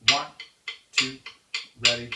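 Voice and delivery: shouted over the drums; loud count-in. Real, not acted. Teacher counting in the five-stroke exercise, shouted. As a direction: call the count clearly.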